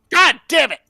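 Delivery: loud, high burst of fury; angry outburst. Acted. Resisting possession, a furious curse. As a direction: snap it out furiously.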